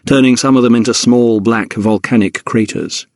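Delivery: ordinary delivery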